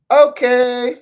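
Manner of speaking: high, loud, eager "okay"; small child. Acted. Child Theo answers the adult teaching him, high and loud. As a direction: pipe up like an obedient little kid. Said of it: "you're a small little child".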